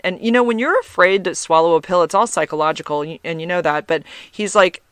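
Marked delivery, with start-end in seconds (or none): none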